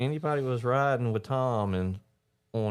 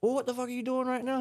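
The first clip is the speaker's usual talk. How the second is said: high exasperated yell. Retold or imitated. Yelling at friend on phone.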